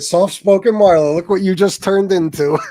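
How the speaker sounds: loud teasing retort; retold mocking taunt. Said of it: "I said, I said".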